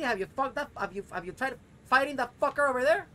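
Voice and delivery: high, loud furious questions; angry rant. Real, not acted. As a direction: vent the frustration loudly. Ranting at game character.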